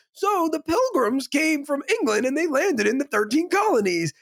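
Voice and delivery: mock teacher. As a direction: lecture brightly as if all is fine. Teacher lecturing amid chaos.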